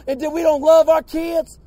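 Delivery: ordinary delivery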